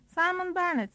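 high, cheery name call; lilting, cheerful hail. A narrator's reading. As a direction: greet with put-on cheer, pitched high. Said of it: "yeteri kadar neşeli bir edayla".

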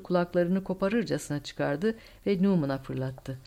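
plain reading voice

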